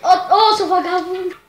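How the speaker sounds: loud angry shout; angry yell. Real, not acted. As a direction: yell it at the cheater. Yelling at a player.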